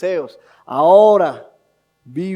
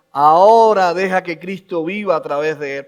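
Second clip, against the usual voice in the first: ordinary talk, nothing marked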